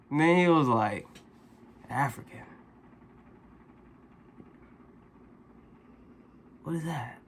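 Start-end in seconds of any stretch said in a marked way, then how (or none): 1.9-7.2 put-on much softer, confused drunk murmur; drunk mumble. Drunk stranger puzzling over African, soft mumble.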